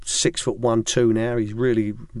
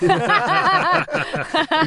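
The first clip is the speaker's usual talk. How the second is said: high burst of laughter; hearty laugh. Real, not acted. Ex-coach laughing at his own story.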